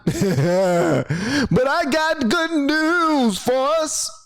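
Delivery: high, slow preacher imitation; preacher mode. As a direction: draw it out high like a mock preacher. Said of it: "got me in my preacher mode".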